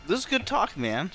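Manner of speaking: usual speaking voice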